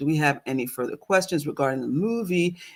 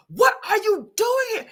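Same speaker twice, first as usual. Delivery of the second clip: shocked annoyed squeal. Retold or imitated. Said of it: "I was so annoyed".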